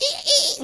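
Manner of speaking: high squeak of a dog toy; imitating a squeaky dog toy; squeaky toy noise. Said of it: "they make those little noises".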